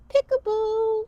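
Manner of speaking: playful peekaboo. Acted. Kids yoga, playful cue.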